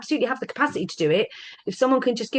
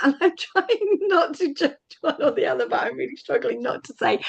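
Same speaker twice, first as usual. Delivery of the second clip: laughing while talking; 7 semitones higher than usual. Real, not acted. Laughs over what she can't say.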